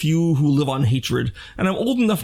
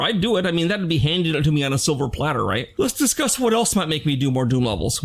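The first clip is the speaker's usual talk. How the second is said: ordinary talk, nothing marked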